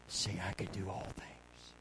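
hushed, very soft whisper; still small whisper. Acted. The inner voice speaking to the believer, small whisper.

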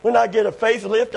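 ordinary talk

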